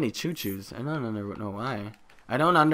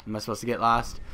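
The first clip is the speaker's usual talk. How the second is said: usual speaking voice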